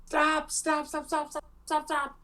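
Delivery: high, frantic repeated stop; frantic yelling. Acted. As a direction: shriek stop over and over. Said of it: "he's like".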